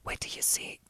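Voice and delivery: soft unvoiced secret whisper; leaning in to whisper. Shown as a demonstration. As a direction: lean in and breathe it. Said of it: "your whisper voice".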